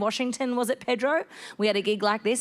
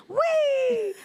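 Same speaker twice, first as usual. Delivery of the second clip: gleeful whee; 4 dB louder than usual. Retold or imitated. Falling off the houseboat.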